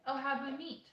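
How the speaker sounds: usual speaking voice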